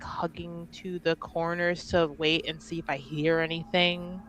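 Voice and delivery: ordinary talk, nothing marked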